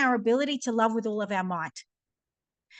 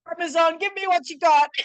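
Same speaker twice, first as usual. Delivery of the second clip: excited call; 6 semitones higher, 4 dB louder than usual. Retold or imitated. Calling out to the plant medicine.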